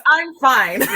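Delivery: high, loud replay of the character's line; Mo's voice. Shown as a demonstration. Player shows co-players the slip into character, loud demo. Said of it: "I said that in Mo's voice".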